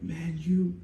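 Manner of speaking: high, harsh drill sergeant chewing out recruits; angry drill sergeant. Retold or imitated. Drill sergeant scolding recruits after the museum, mock angry yell. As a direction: bark it like a furious drill sergeant. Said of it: "the drill sergeant was like".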